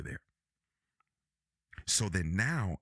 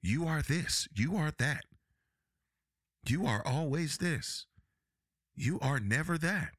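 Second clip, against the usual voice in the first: accusing you ares; 6 semitones higher than usual. Retold or imitated. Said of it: "focus on the you ares".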